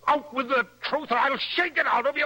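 angry demand. Acted. Jealous husband threatening the young poet, rising fury. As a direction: lose your temper and demand it again. Husband losing his temper.

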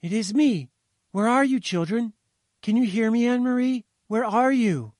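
put-on high, loud calling to a child; urgent mother shouting down the phone; triumphant shout. A narrator's reading. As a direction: call out to a lost child, pitched high. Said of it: "she shouted triumphantly".

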